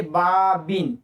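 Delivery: बाबिन said slowly, ending on a clear n; slow tanween demo. Shown as a demonstration. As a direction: read बाबिन slowly, letting the n sound. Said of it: "कसरा यानी जेर की दी है".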